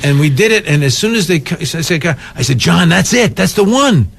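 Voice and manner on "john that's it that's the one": high, emphatic retold exclamation; excited insisting. Actor tells director the take worked, excited insisting. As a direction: insist on it with pitch raised. Acted exchange after the take.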